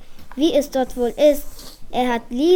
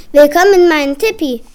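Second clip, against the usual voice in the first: louder, proud host voice; hearty welcome; 3 semitones higher, 9 dB louder, faster than usual. Acted. Bitte recht freundlich welcomes Cowboy Klaus to his tipi, hearty and loud. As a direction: boom out a big welcome.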